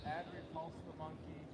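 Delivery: steady counted form calls; tai chi instructor. Acted. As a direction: announce each move and count.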